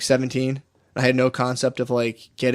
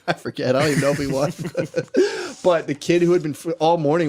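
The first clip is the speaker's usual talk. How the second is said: ordinary delivery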